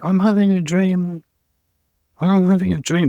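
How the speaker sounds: God dreaming. Retold or imitated. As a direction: float it like God half-asleep in a dream. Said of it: "is God going".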